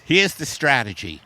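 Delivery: usual speaking voice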